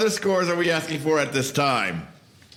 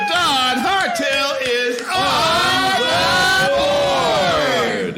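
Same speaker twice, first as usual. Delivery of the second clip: slow, booming higher announcer call; announcer shout; 8 semitones higher, 4 dB louder, slower than usual. Acted. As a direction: announce him like a game-show host. Host announces next contestant.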